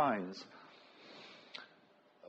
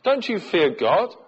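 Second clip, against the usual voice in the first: slow, higher-pitched rebuke; rebuking; 4 semitones higher, 4 dB louder than usual. A narrator's reading. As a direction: scold him with a slow, raised question. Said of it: "the other criminal rebuked him".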